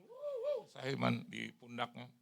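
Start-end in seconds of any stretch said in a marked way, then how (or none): 0.0-0.6 very high sobbing gasps; imitated sobbing. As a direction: gasp high like crying. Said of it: "Dia kan, dia gitu".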